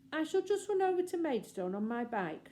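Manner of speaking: put-on airy, offhand young gentleman. A narrator's reading. Grown-up brother dismissing the children, airy and offhand. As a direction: brush them off lightly, airily.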